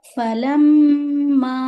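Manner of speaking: فَلَمَّا with a long nasal hum on the doubled m; slow chanted فَلَمَّا, humming through the m; ghunna. Shown as a demonstration. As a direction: hold the m of فَلَمَّا for two beats. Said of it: "میم پر غنہ کریں گے دو حرکت".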